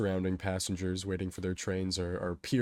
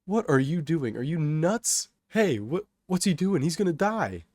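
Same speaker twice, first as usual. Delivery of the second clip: loud, high, panicked protest; alarmed onlookers; 9 semitones higher, 7 dB louder than usual. A narrator's reading. Onlookers to a man climbing onto the tracks, alarmed shouting. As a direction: cry out in disbelief, voice pitched up. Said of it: "some onlookers mention".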